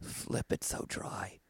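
unvoiced creepy aunt whisper; teasing whisper. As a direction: breathe it like a secret. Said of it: "my aunt comes up to me and goes".

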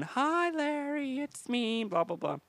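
put-on high, soft, drawn-out girlish voice; imitated girl on phone. Comic imitating the girl greeting Larry, high and singsong. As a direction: coo it in a mock girl's voice. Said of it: "And she gets on the phone".

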